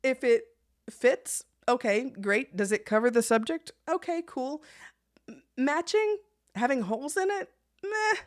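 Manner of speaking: high-pitched, dismissive shrug of a voice; careless meh. Pretend. Mimicking a laid-back phlegmatic about clothes, high careless meh. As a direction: toss it off like nothing matters.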